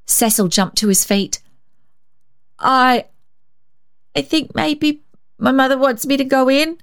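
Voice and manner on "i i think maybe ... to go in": put-on high, halting nervous boy voice; trying to slip away; stammering. A narrator's reading. Cecil making excuses after the spill, high nervous stammer. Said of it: "he stammered".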